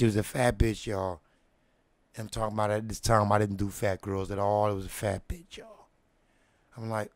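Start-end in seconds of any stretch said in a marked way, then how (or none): none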